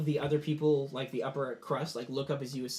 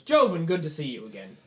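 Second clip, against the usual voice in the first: hearty greeting; 7 dB louder than usual. Acted. General greets Joven striding up.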